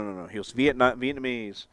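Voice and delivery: ordinary delivery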